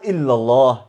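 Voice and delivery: normal talking voice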